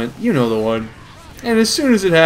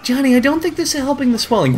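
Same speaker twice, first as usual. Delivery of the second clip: mom's line; 10 semitones higher, faster than usual. Retold or imitated. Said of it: "And she says".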